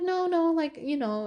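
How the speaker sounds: high, eager pushback; cutting her friend off with a quick no; insistent protest. Retold or imitated. Retelling arguing with her best friend, insistent.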